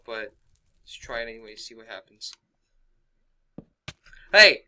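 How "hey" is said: loud alarmed yell; shouting. Real, not acted. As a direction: shout to stop the attacker. Being attacked in game.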